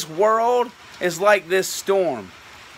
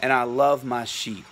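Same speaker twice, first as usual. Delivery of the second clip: tender shepherd voice; 7 semitones lower, 4 dB quieter than usual. Retold or imitated. Preacher voicing the Good Shepherd to his listeners, low and tender. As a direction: lower and softer, gently reassuring. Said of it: "because he says".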